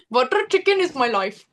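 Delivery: playful put-on Indian accent. Shown as a demonstration. Singer adding a line for the laughing host, Indian accent. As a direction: improvise in a mock Indian accent. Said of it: "Can you do an Indian accent?".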